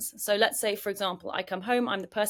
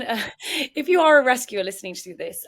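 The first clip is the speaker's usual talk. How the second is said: knowing laugh breaking into the words; louder, laughing as you speak; 3 semitones higher, 8 dB louder than usual. Real, not acted. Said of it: "I'm laughing because this happens to me".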